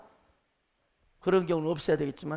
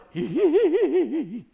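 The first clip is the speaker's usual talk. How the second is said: high, wavering ghostly giggle; eerie tittering laugh of a virgin ghost; maiden ghost cackle; 7 semitones higher, 6 dB louder than usual. Acted. Preacher mocks the popular image of the devil, ghostly cackle. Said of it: "처녀 귀신".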